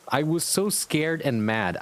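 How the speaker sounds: ordinary talk, nothing marked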